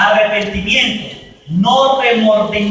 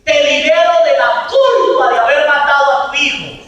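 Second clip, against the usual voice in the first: louder, higher absolution; 6 semitones higher, 6 dB louder than usual. Retold or imitated. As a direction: declare the release firmly. Priest giving absolution.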